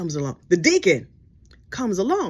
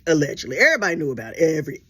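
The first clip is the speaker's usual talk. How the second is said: usual speaking voice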